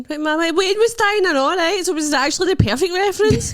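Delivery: real high-pitched, laughing outburst; loud protest. Real, not acted. Defending a dark joke to the co-host, loud protest. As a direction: protest loudly, voice shooting right up.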